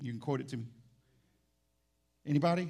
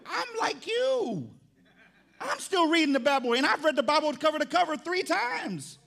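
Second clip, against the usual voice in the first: exasperated shout; 11 semitones higher, 5 dB louder than usual. Acted. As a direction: cry out in comic indignation, loud and high. Pastor protesting to shocked member.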